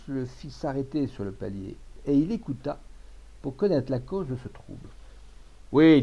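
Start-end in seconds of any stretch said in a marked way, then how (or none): 5.7-6.0 loud indignant burst; angry. A narrator's reading. Old Auvergnat lodger accusing his housemates, angry. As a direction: fire it off loudly, in anger. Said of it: "disait l'Auvergnat avec colère".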